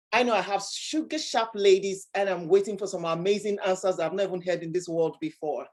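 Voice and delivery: ordinary talk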